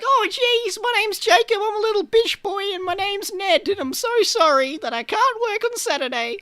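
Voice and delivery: high, whiny mock-polite voice; mocking a meek pushover. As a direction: squeak it out like a timid pushover apologizing. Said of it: "You're probably like".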